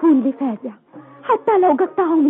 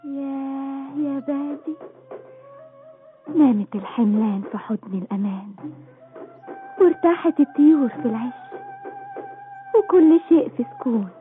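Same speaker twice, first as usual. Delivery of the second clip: dreamy and soft; 4 semitones lower, 5 dB quieter than usual. Acted. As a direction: murmur it like a lullaby. Dreamy night description. (همس حالم هادئ)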